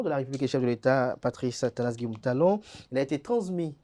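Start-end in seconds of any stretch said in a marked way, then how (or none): none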